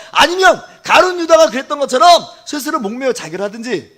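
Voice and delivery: loud, high, driving sermon; full-force preaching. Real, not acted. (목청 높여 몰아치는 설교조)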